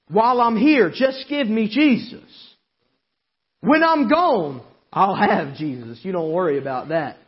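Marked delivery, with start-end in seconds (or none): 0.1-6.0 raised, driving preacher's cry; fiery preaching. Acted. Preacher shouting to the congregation about Jesus. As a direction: preach it out full force to the church.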